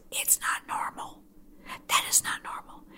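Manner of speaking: a hushed, conspiratorial whisper; whispered aside. Acted. Whispering to viewers that it's not normal. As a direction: lean in and whisper it like a secret.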